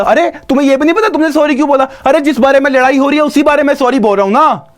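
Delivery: worked-up comic protest; exasperated arguing. Acted. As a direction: argue back in rising exasperation. Acted quarrel over saying sorry.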